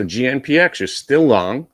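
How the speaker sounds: loud, accidental British accent; British-tinged, emphatic delivery. Real, not acted. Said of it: "slipping into a British accent there".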